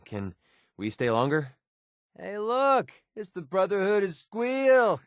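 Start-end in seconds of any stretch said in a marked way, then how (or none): 2.2-5.0 high, drawn-out taunting call; mocking jeer. Companion spotting the Brotherhood, high mocking jeer. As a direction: stretch out the joke name in a high pitch.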